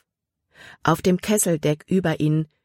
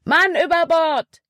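high, loud urgent call with a falling end; shouted alarm; 12 semitones higher, 4 dB louder than usual. A narrator's reading. Caleb calls out to the crew, shouting. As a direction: yell it out to the crew. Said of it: "rief Caleb".

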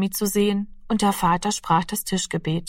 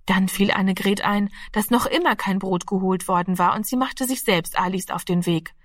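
plain reading voice

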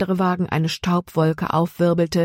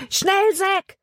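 very high-pitched, pressing cry; urgent yell. A narrator's reading. Jonathan urges Zack to flee, urgent yell. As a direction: yell it high to hurry someone along. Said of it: "schrie er".

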